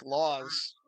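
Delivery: normal talking voice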